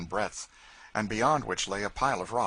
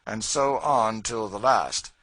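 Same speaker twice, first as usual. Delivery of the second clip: louder and slow, proud and firm; George, defiant free man; 3 semitones higher, 8 dB louder, slower than usual. A narrator's reading. George to the slave catchers, defiant declaration. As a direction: stand tall and declare it.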